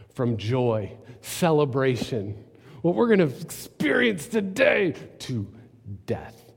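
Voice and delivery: usual speaking voice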